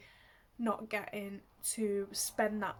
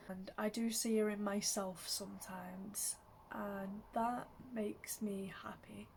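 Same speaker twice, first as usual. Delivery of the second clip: whisper; 8 dB quieter than usual. Real, not acted. Tender admission about her late mum.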